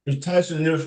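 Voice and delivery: speaking through a mouthful of popcorn; chewing while asking; mouth full. Real, not acted. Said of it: "I got some popcorn in my mouth".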